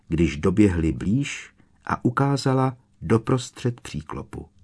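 usual speaking voice

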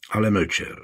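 ordinary delivery, nothing marked

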